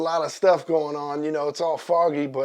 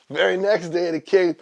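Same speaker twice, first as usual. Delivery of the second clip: ordinary delivery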